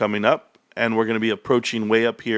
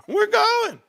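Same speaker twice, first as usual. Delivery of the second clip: much higher, squeaked we're going; squeaky repeat. Pretend. Promising viewers the stock will run, squeaky and playful.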